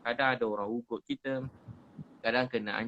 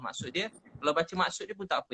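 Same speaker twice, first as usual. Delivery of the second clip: normal talking voice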